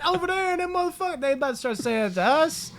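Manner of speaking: high-pitched retold worry; mock alarm. Telling how group might turn on them, high mock alarm.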